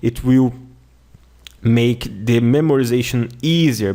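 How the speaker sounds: normal talking voice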